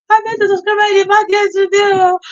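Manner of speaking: high, loud and fast retelling; excited. Real, not acted. Vlogger telling viewers about a subscriber, high excited rush. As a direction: blurt it out thrilled.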